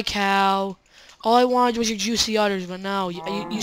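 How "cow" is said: ordinary delivery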